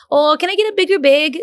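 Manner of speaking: light Minnesotan ordering; Minnesota accent. Retold or imitated. Imitating Minnesota friends ordering food, light Minnesota accent. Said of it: "we talk about Minnesota".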